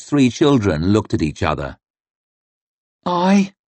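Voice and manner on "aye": put-on high small girl's voice; a timid little girl answering; tiny voice. A narrator's reading. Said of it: "in a tiny voice".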